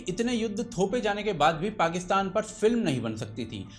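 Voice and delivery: usual speaking voice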